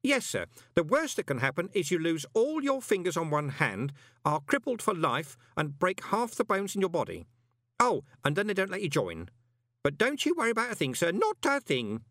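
put-on high-pitched, earnest old postman; eager old postman. A narrator's reading. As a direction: helpfully list the horrors, then reassure. Groat lists dangers, then reassures.

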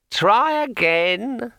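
high, slow, cheering sword voice; encouraging talking sword. A narrator's reading. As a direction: urge him on from the branch. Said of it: "the sword said encouragingly".

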